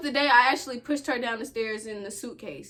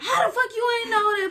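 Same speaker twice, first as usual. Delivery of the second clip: mock angry mom; 8 semitones higher than usual. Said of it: "She was like".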